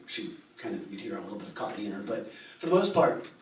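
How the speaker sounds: ordinary talk, nothing marked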